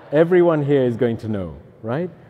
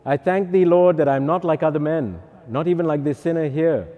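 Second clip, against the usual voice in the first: raised, mock-pompous prayer; higher, showy self-congratulation; boasting Pharisee; 3 semitones higher, 3 dB louder than usual. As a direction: proclaim it like a self-righteous Pharisee. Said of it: "The Pharisee".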